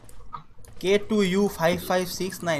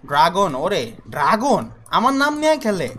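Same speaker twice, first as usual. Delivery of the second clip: indignant; 5 semitones higher, 5 dB louder, slower than usual. Real, not acted. Outraged at rival using his name.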